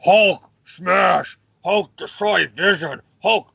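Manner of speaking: She-Hulk turning into the Hulk, mock monster rage; hammed-up Hulk roar. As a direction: play a dumb raging Hulk, half joking. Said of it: "as her rage is like".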